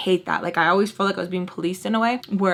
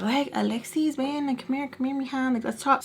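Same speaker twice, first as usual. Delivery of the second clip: higher, softer pleading; coaxing aunt; 5 semitones higher, 5 dB quieter than usual. Retold or imitated. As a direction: gently coax her over to talk. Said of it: "the aunt keeps trying to call me".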